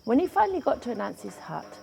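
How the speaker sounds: ordinary delivery, nothing marked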